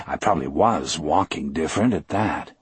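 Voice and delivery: plain reading voice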